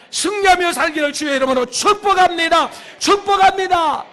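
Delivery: high, loud preacher's blessing; full-force revival preaching; shouted blessing. Real, not acted. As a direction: belt the blessing out to the congregation.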